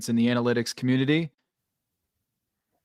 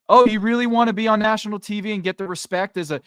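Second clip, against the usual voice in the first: mock NBA taunt; 7 semitones higher, 4 dB louder than usual.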